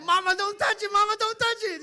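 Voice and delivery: very high, loud squealing plea; mock mama's-boy squeal. Teasing Donnie about his mom, high squeal. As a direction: squeal it like a scared child.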